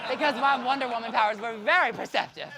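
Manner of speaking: loud, high laughing brag. Real, not acted. Bragging to the audience about her Wonder Woman powers, loud laughing. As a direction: crow about it through laughter.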